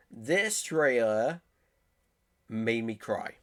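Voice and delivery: slow, weighty stress on "This trailer made me cry"; dead serious to camera. Real, not acted. To the camera, confessing the trailer moved him, slow and earnest. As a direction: spell out "This" and "made" slowly, each word landing. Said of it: "I'm gonna say this dead to the camera".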